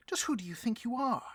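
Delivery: high, loud scold; indignant scolding. Acted. Boss berating his right hand, high and loud. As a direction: put him in his place.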